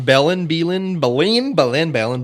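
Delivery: Belen said several ways, stress and vowels shifting each time; trying out pronunciations. Shown as a demonstration. Podcaster covering every pronunciation of the town, Belen varied each time. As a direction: run through Belen with a different vowel each try. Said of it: "Use them all, Greg, so no one can correct me".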